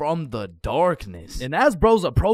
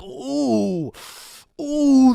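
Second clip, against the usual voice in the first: riled ooh; 8 semitones higher, 4 dB louder than usual. Acted. Acting riled up about cramps.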